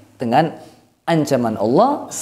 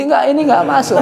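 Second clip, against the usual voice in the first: ordinary talk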